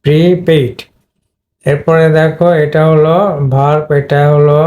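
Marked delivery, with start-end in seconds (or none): 0.0-0.8 প্রিপেইড with a long "pree"; pree-PAID; long i sound. Shown as a demonstration. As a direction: hold the ee in প্রিপেইড, main stress on "paid". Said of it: "long ই sound create হয়েছে".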